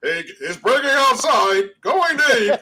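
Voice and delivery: slow, stiff robot sports announcer; robotic announcer voice. Retold or imitated. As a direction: read it like an old video game commentator. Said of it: "It was a robotic voice".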